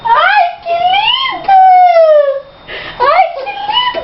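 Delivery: squealing high "ai, que lindo"; high cooing. Real, not acted. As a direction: coo it up high with delight. Mother cooing over baby.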